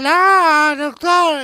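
put-on high, loud old lady on the phone; mock old lady call. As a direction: squeak it like a worried granny. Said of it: "me dice".

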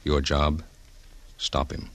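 ordinary talk

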